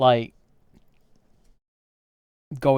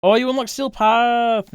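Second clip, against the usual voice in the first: loud, high burst of delight; excited cheer; 11 semitones higher, 6 dB louder than usual. Real, not acted. Streamer cheering a friend unlocking Steel Path, excited shout. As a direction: exclaim it high and loud, thrilled for them.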